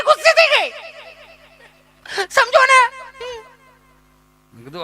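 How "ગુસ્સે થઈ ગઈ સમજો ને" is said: high, louder mock whimper; much higher, louder mock panic; mimicking wailing lady. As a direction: wail it high and desperate.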